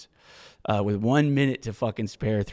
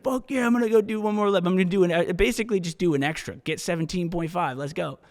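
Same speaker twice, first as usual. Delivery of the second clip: pumped up; 6 semitones higher than usual. Retold or imitated. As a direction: fire yourself up for the extra lap. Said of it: "And I was like".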